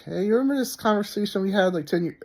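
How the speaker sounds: high, fast, sing-song imitation of the wife; mocking wife voice. Wife beating around the bush with her husband, high mocking voice.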